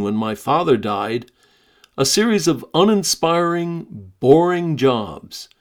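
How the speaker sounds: lively, expressive reading of a memoir. Acted. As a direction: read the story aloud with energy and emphasis. Host reads Keith's memoir aloud.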